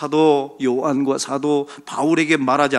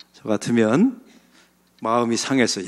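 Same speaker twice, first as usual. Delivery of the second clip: ordinary talk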